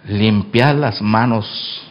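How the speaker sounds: slow, low, soft command; solemn scripture. Acted. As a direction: intone it gravely. (cita bíblica solemne y lenta)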